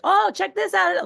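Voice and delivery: high, loud hype; mock over-the-top excitement; loud excited. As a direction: blast it like an over-eager rep. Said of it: "he's not gonna be like".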